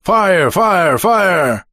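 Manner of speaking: high repeated battle cry in English; shouted order. Acted. British officer to his riflemen, shouting. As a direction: call out the order to shoot.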